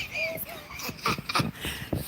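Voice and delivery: squeaky high laugh; high giggle. Real, not acted. Laugh between whispers.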